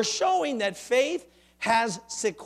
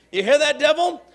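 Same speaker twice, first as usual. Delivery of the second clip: louder, higher challenge; loud taunt; 6 semitones higher, 8 dB louder than usual. Acted. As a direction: dare the devil to listen. Preacher taunts the devil.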